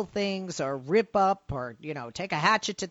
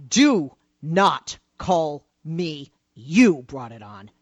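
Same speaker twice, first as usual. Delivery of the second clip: stern warning; 5 semitones lower, 4 dB louder, slower than usual. Real, not acted. Host delivers the punchline warning.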